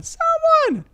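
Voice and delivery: high mock cry for help; theatrical high-pitched cry; acted scream. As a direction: call out as if screaming. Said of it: "She screams, "Ah!" for someone".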